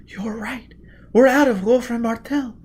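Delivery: put-on high, hushed then rising girl's voice; amazed young woman. A narrator's reading. Gisbella realizing with Foyle that they got out. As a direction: breathe it in wonder, then lift. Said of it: "whispered incredulously".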